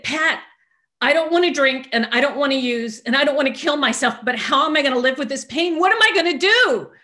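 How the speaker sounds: high, loud desperate outburst; retold screaming. Venting to her sponsor about pain, screaming. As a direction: wail it at full voice, pitch climbing to the last question. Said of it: "I'm screaming at the top of my lungs".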